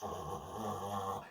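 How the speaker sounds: deep creaky fry; vocal fry. Shown as a demonstration. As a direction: crackle low in fry. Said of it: "that vocal fry sound".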